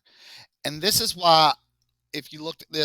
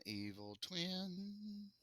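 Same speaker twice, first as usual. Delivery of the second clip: spooky evil voice; 6 semitones higher, 18 dB quieter, much slower than usual. Pretend. Naming the evil twin playfully.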